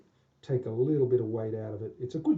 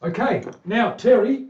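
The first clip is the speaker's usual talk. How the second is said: calling out; 9 semitones higher, 11 dB louder, slower than usual. Real, not acted. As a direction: call Terry's name high and loud. Calling out to Terry.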